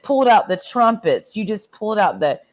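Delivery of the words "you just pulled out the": ordinary talk, nothing marked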